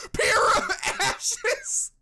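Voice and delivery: high, loud hammed-up crying shout; mock wail. Hammed-up wail over a character turned to ashes. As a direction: wail it out like fake grief.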